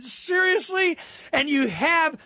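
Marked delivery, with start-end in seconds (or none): 0.0-1.0 much higher, disbelieving seriously; can't believe it; mock outraged disbelief; incredulous. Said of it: "And I'm going".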